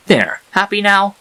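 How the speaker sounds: fed-up sarcasm at the giggling foal; exasperated. Acted. Statue lifts foal, exasperated.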